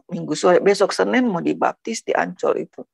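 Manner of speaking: usual speaking voice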